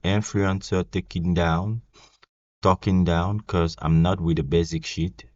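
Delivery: reading rap lyrics in a French-accented English. Real, not acted. French vlogger reads [name]'s lyrics aloud, French-accented English. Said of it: "Je dois encore travailler mon accent".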